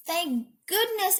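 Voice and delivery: high, drawn-out relief; relieved cry; exclaiming. A narrator's reading. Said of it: "exclaimed the man".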